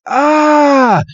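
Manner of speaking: very high, loud scream; mock scream. Psyduck jumps at Red, who screams. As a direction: cry out as the ghost leaps at you. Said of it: "And Red's like".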